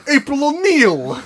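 high, slow Brooklyn drawl; a hammy Brooklyn dude calling out; Brooklyn accent. Retold or imitated. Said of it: "just a Brooklyn, Brooklyn dude".

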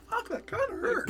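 high, strained mock whimper; holding back tears. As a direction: squeeze it out high through watery eyes. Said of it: "the eye water and tears".

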